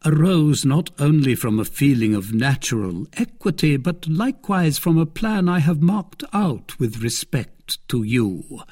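lightly voiced stately cardinal, measured; a hint of a lofty cardinal. A narrator's reading.

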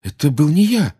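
high, loud, urgent protest; boy cutting in. A narrator's reading. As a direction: jump in to clear yourself. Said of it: "вмешался Гарри".

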